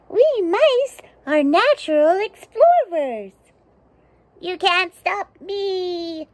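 high, squeaky little mouse, slow on the second line; a bold tiny explorer. Acted. Mouse defying the narrator, high and squeaky.

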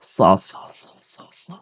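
সা as a voiceless hiss of air; breathy hiss, almost silent; air only. Shown as a demonstration. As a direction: let the air out for সা. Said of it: "বাতাসটা কেমন বেরোবে?".

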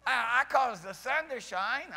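slow proud declaration. Retold or imitated. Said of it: "he's telling us".

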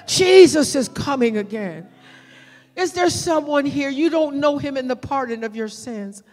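ordinary talk

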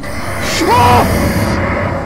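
loud, high scream; sudden piercing yell. Real, not acted. Startled scream in horror game.

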